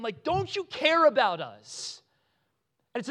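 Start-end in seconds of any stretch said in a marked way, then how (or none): 0.2-2.0 high, loud, drawn-out mock plea; retold panic. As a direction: imitate freaked-out disciples pleading. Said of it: "freaking out, and they're waking him".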